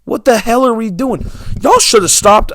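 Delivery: infuriated outburst. Real, not acted. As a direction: vent your fury at the studio. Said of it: "I'm so infuriated".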